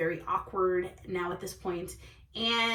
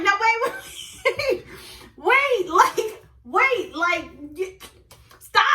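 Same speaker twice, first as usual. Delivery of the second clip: high, louder, urgent wait wait; frantic calling; 9 semitones higher, 5 dB louder, slower than usual. Retold or imitated. Retold calling after the guy walking away, high and frantic. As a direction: call him back before he walks off. Begging him not to leave.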